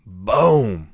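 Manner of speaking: loud, drawn-out boom; imitated impact. Storyteller imitating the cabin getting hit, sudden loud boom. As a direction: let the boom burst out long and loud.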